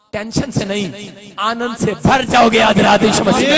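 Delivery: slow then loud preacher's shout; preacher shouting a promise of joy to the church; preaching climax. Real, not acted. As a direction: lift the crowd into joy. Preaching at full force.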